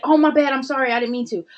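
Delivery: high, loud, sing-song sorry; mock sheepish apology. Friend brushing off the warning, hammed-up high and loud. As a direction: imitate a careless quick apology.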